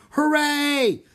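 high, loud, drawn-out cheer; crowd yelling. A narrator's reading. People in the audience cheering, yelling. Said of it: "Jack heard people yelling".

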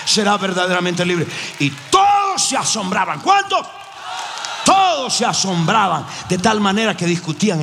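preaching at full force. Real, not acted. Preacher rousing the congregation, fiery full-force preaching. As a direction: drive the point home to the crowd.

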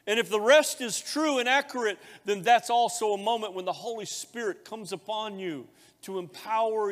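loud, high, ringing pulpit voice; full-force preaching. Acted. Pastor preaching the baptism story, loud and high. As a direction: preach it out to the whole church.

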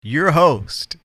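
high, slow, booming host voice; radio host voice. Acted. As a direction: announce it like a radio DJ. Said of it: "Not that intro".

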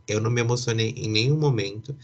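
usual speaking voice

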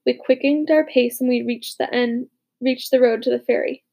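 plain reading voice

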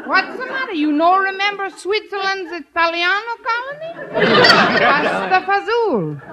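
mock Italian accent. Traveller teasing principal about Italian Alps, laughing mock Italian accent. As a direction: tease him like a cheery Italian guide.

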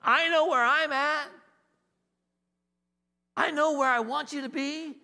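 high, deliberate voice of God speaking to man; God calling out. Acted. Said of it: "It was as if God were saying".

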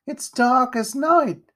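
put-on high, loud, slow boyish complaint; high-pitched urchin protest; protesting young boy. A narrator's reading. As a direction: whine it out high and drawn out. Boy protests at the dark chimney.

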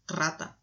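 "rata" with a rolled opening R; initial strong R. Shown as a demonstration. Friend explaining a spelling rule, strong trilled "rata". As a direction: roll the first R of "rata". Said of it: "comenzás una palabra escribiendo con R".

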